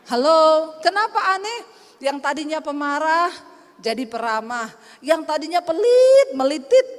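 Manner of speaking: loud preaching call to the congregation. Acted. Preacher calls out to the congregation, loud and rousing. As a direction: rouse the crowd, loud and punchy.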